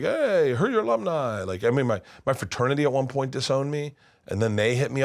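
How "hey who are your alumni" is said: chirpy school caller. Retold or imitated. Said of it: "they're like".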